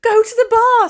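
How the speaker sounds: high, loud excited Welsh man cheering; Welsh accent. Retold or imitated. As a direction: belt it out in a big Welsh accent, delighted. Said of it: "try and do the Welsh accent".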